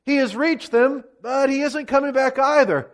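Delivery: high-pitched sentry call; lookout reporting. A narrator's reading. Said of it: "The lookout reported".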